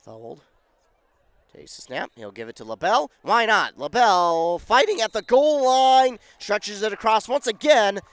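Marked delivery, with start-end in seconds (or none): 3.2-8.0 higher and louder, stretched on fighting; excited call. Real, not acted. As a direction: drive the two-point play home. Two-point conversion call.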